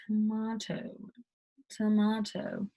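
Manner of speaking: "tomato" said to-mah-to; British tomato. Shown as a demonstration. Teacher demos the British "tomato" to learners. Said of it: "is tomato".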